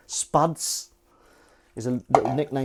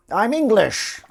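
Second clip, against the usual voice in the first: much higher and louder proclamation; loud declaration; 11 semitones higher, 9 dB louder, slower than usual. Real, not acted. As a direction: announce it proudly. Declaring nationality emphatically.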